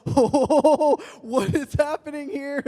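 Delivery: high, drawn-out mock alarm; overwhelmed astonishment. Guy ambushed by twelve cheering friends, overwhelmed. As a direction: reel back from the commotion, voice up high.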